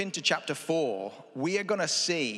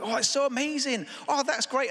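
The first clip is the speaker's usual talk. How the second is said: bright, much higher, gushing over the top; gushing praise; 9 semitones higher than usual. Retold or imitated. To a street preacher, higher gushing praise. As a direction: pile on polite enthusiasm. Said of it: "you're like".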